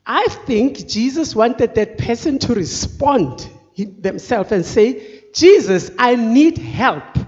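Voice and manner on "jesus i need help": loud call, then slow plea; pleading cry. Acted. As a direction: call out for help, drawing it out. Acted plea crying out to Jesus.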